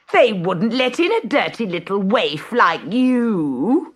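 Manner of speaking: high, nasty sneer. Acted. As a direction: look down on her as you say it. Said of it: "sneered Gertrude".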